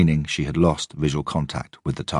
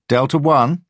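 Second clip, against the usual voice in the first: radio call; 11 semitones higher, 7 dB louder, slower than usual. A narrator's reading. Surveillance officer calls on radio.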